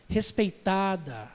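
ordinary talk, nothing marked

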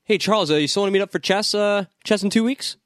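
mock buddy voice. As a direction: chat like old pals in a silly voice. Voicing Magneto/Xavier banter.

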